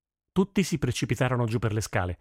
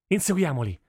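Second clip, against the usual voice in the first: narrated battle cry; mild shouted order; 6 semitones higher than usual. A narrator's reading. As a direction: call the troops to chase, restrained. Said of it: "urlò Nihal".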